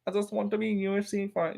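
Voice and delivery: high, softened put-on voice; Jake Hadley. Streamer mimicking a UK fighter, high and soft. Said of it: "that's the Hadley impression".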